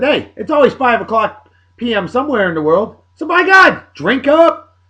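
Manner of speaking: rising to a high, loud cheer; rally the chat to drink up; hyped-up toast. Retold or imitated. Retold toast to chat, pitch climbing into a loud cheer. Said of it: "And I chimed in and said".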